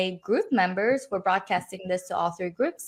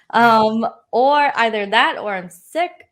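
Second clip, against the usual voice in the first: ordinary delivery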